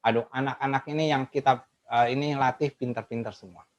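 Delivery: soft, sincere, gently pleased; positive affirmation. Retold or imitated. Teacher tells herself the pupils are smart, soft sincere. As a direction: affirm the children quietly before class.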